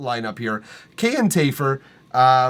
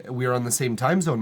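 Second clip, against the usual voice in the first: ordinary delivery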